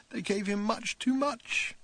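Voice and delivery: high, slow, tearful; on the edge of tears; crying. A narrator's reading. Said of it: "He started to cry".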